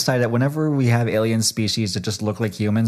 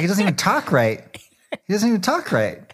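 high-pitched mock complaint; complaining brother; 8 semitones higher than usual. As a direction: complain like a resentful sibling, voice pitched up. Voices the brother who hates him.

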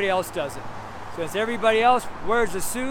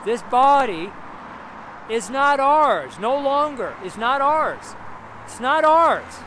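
full-force preaching; 4 semitones higher, slower than usual. Acted. Street minister preaching hard to believers about their bodies.

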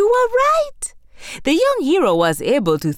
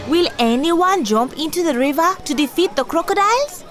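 put-on young boy voice; 10 semitones higher than usual. Boy challenges townspeople.

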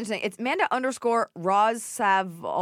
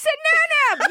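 excited; 7 dB louder than usual. Real, not acted. Delighted echo of backwards word.

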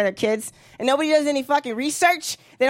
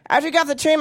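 ordinary talk

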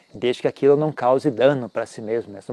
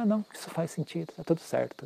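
soft relieved murmur; 3 semitones higher, 12 dB quieter than usual. Retold or imitated.

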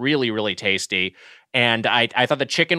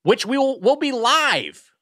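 excited announcement; 11 semitones higher, slower than usual. Real, not acted. Hyping the live finale.

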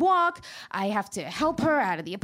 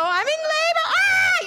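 very high, louder mock wail; mock panicked woman; 6 dB louder than usual. Laboring woman texting her mom in mock panic. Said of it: "texting their mom".

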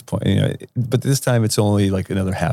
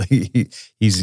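ordinary delivery, nothing marked

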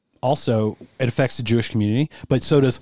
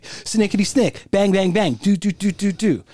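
playful sound effects; 7 semitones higher, slower than usual. Pretend. Imitating Jay noises.

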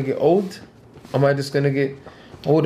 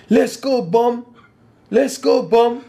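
Shannon Briggs shout; 8 semitones higher, slower than usual. Retold or imitated. Fighter mocking a rival by shouting his catchphrase at him. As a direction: bellow it like a hype-man heckler. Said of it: "start doing Shannon Briggs in him".

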